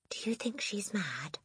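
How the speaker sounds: put-on hushed, breathy child's question; whispered question. Lavender whispering to Matilda about the Trunchbull. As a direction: whisper it so no one hears. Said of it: "Lavender asked".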